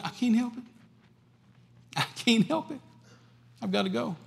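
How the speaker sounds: high, helpless plea; Paul answering the prophet, high and helpless; Paul's helpless plea. Acted. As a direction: pitch it high, as if it cannot be helped.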